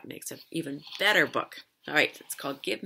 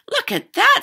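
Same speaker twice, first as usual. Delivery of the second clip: high, louder exclamation of discovery; excited girl; 16 dB louder than usual. A narrator's reading. Girl spotting a candy bar on the ice, excited. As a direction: pipe up high, thrilled at a find.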